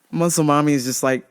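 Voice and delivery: ordinary delivery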